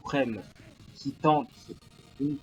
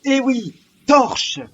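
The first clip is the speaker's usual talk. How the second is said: high, loud excited shout; 11 semitones higher, 13 dB louder, much slower than usual. Acted. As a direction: yell it like a goal is coming. Goal chance in live commentary.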